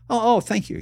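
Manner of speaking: put-on a high, grateful young comic; lightly voiced eager young comic; eager kid. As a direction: squeak out thanks like a nervous kid.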